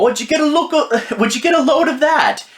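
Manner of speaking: high mock British accent. Mocking the show's British characters, in a high hammed-up British accent. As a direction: squeak it out like a cheery Brit who has just been stabbed. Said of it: "they look at the knife and go".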